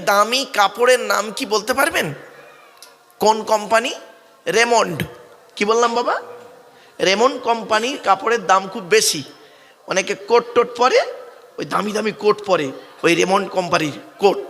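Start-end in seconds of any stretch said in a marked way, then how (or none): none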